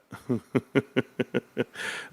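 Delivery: rapid staccato giggle; amused chuckle. Real, not acted. Streamer amused he forgot his post, amused chuckle. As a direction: chuckle along in a long run of short beats.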